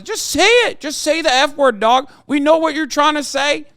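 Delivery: high, loud exasperated demand; heated rant. Real, not acted. Streamer taunting posters, loud heated rant. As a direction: yell it at them, fed up.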